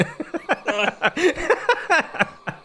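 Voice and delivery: long high burst of laughter; big laugh. Real, not acted. Player bursting out laughing at friend's cards. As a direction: crack up at the reveal.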